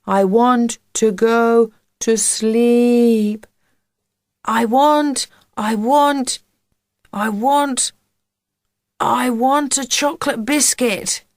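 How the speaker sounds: slow, high droning chant; lifeless trance. A narrator's reading. Boy pretending to be under a spell, trance voice. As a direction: drone it as if hypnotised. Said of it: "Podge's voice was strange and lifeless".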